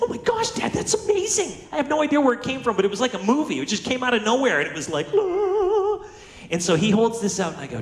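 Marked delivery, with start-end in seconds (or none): 0.0-1.6 put-on much higher, thrilled little boy; excited kid. Boy gets a gift from dad. 5.1-6.0 amazed gasp. Retold or imitated. Boy reacts to the gift.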